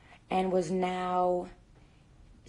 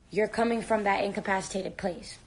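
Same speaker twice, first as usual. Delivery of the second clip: ordinary talk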